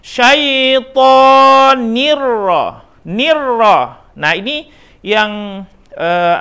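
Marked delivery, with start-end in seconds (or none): 0.0-2.2 "Syaitonir" stretched very slowly, rising; slow recitation. Shown as a demonstration. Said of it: "koreksi bacaannya".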